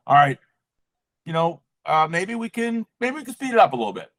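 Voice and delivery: high, light, playful coaxing to spirit; sweetly high as if bargaining with spirit. Retold or imitated. In the shower, high playful coaxing.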